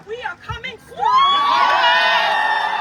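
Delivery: slow, loud rallying cry; rally shout. Real, not acted. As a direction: shout it out to the crowd. Rally speech rousing the crowd.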